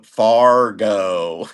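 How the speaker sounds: Fargo with a stretched North Dakota oh at the end, Fargooo; playful Upper Midwest accent on Fargo; mock North Dakota Fargo. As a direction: hold the last vowel of Fargo long like a North Dakotan. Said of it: "How do they pronounce it? They go".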